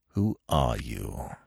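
low, slow, soft demand; stern demand. A narrator's reading. Smith confronting the captive woman, stern demand. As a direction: demand it slowly, with quiet authority. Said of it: "he demanded".

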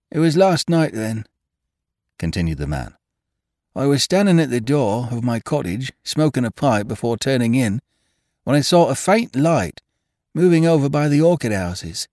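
high, deferential servant voice; a nervous old gardener pleading; humble gardener voice. A narrator's reading.

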